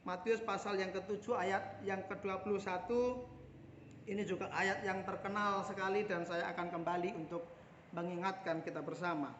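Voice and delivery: ordinary delivery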